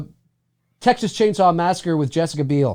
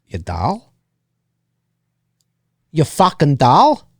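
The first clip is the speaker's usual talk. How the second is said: mock Chicago accent growing stronger; slower than usual. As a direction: push the Chicago tough guy. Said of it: "Such a Chicago accent".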